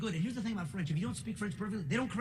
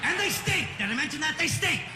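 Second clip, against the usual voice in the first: higher, louder comic complaint; indignant outburst; 8 semitones higher, 5 dB louder than usual. Acted. Comedian ranting to the audience about the French, loud and indignant. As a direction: bark it out in disgust.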